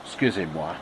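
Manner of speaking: put-on low French-accented aside.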